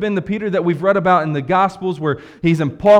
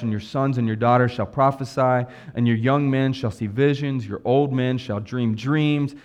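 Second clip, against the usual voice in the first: solemn scripture reading; 6 semitones lower than usual. Acted. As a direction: read the prophecy low and solemn. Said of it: "what was uttered through the prophet Joel".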